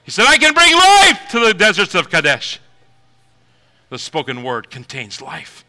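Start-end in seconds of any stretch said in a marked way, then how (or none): 0.3-2.6 loud, higher declaration; God's proclamation. Acted. God promising life to the desert, loud proclamation. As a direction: declare it as the Lord.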